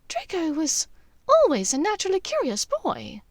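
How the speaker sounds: waspish. A narrator's reading. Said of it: "a bit waspishly".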